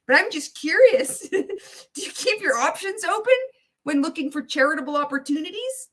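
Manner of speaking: hammed-up nervous begging; mock pitch call. Streamer mocking Ray asking millionaires, squeaky and high.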